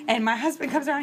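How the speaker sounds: ordinary delivery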